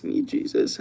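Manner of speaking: whispered under the breath; hushed mock-pious whisper; whispered aside. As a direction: mutter it to yourself, voiceless.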